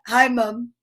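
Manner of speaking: lightly imitated child greeting mom; Ryan. Host guessing how Ryan says it, light imitation. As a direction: give a slight put-on voice, as he'd say it. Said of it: "he's probably like this".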